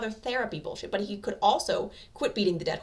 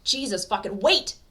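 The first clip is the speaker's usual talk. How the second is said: much louder, rising shout; desperate cry; 4 semitones higher, 10 dB louder, slower than usual. A narrator's reading. Katsuki stopping Deku from jumping, desperate shout. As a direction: yell to stop him jumping. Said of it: "Katsuki cries".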